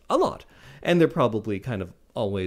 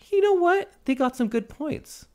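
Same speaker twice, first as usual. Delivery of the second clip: high, light shrugging voice; a casual go-along guy, pitched up; meek everyman; 11 semitones higher than usual. Retold or imitated. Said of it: "guy who could be anyone being like".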